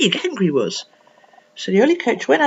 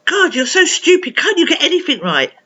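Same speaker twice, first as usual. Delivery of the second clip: higher-pitched, acted exasperation; exasperated scolding; 7 semitones higher, 3 dB louder than usual. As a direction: scold like a fed-up grown-up. Acts out people scolding Emma.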